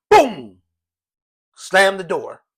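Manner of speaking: loud shouted boom; sudden loud sound-effect shout; door slam. Retold or imitated. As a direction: blast it like a slammed door. Door slammed in their face.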